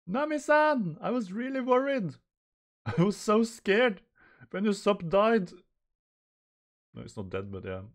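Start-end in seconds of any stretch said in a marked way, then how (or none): 0.1-5.5 high, loud overwrought wail; fretting crewmate. Acted. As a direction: cry out with hammed-up worry.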